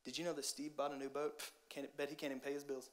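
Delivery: low, soft gossiping murmur; catty gossip. Retold or imitated. Jealous Gene gossiping about Steve's boat, low and soft. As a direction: sneer at Steve behind his back, softly. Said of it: "It'll sound like this".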